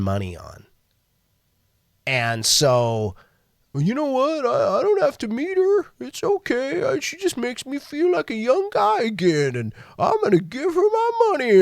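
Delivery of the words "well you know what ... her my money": much higher, wistful put-on voice; mocking a lonely old man. Mocking a lonely man sending money to a scammer, high wistful voice. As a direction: play a lovesick fool justifying himself.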